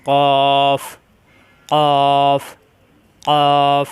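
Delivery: "কফ" thick and loud from the back of the tongue; long, full, rounded "কফ" on a level tone; heavy articulation. Shown as a demonstration. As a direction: press tongue root to palate for a loud heavy "কফ". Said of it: "মোটা করে উচ্চারণ".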